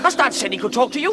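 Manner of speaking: high and loud; angry challenge. Acted. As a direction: throw it at her. Brother challenges his sister.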